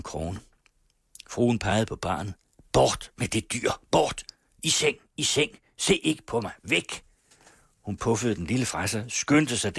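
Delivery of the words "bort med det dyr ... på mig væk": higher, agitated shooing; a shaken old lady sending the child off; frantic dismissal. A narrator's reading. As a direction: drive the child away in distress. Baroness drives child away.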